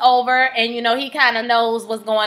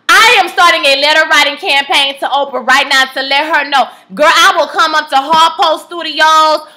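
fired-up rant; 4 semitones higher, 6 dB louder than usual. Real, not acted. Ranting threat to come to Harpo.

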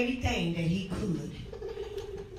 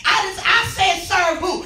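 hollering revival preacher; 6 semitones higher, 7 dB louder than usual. Real, not acted. Hollering as God.

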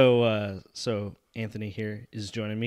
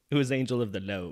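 country accent, Lord as load; faster than usual. Shown as a demonstration. Host demonstrating the country accent for Anthony, "Lord" said "load". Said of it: "instead of Lord, it's like load".

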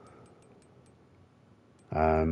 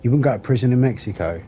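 surprised; 5 semitones higher, 6 dB louder than usual. Real, not acted. Reacting to friend's claim.

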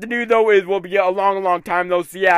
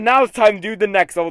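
ordinary delivery

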